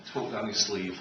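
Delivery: normal talking voice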